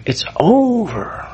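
slow, high, drawn-out despair. Retold or imitated. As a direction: let the words sink in defeat. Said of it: "I saw myself as a total moral failure".

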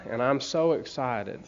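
ordinary delivery

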